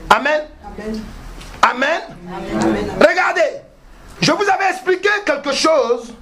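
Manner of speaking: high, emphatic preacher's delivery; full-force preaching. Acted. Preacher reminding the congregation of his teaching, high emphatic preaching. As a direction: proclaim it high to the whole congregation.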